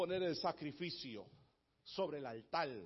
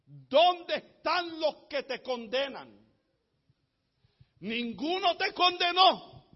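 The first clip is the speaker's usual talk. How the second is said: slow, probing question, rising in pitch and force; preacher voicing a challenging question; questioning teacher; 6 semitones higher, 10 dB louder, slower than usual. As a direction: ask it slowly, letting each word land. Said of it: "le preguntó a ella".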